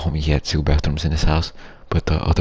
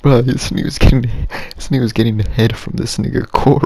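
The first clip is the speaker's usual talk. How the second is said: higher and louder, worked up; excited telling; 7 semitones higher, 6 dB louder than usual. Real, not acted. Telling friends what he saw through the window, excited. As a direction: blurt it out, amazed at what you saw.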